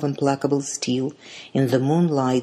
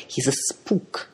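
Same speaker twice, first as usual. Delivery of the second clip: loathing; 3 semitones higher than usual. A narrator's reading. Middle sister warns about the lover, loathing. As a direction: denounce him with understated loathing. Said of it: "voice was shaking with loathing".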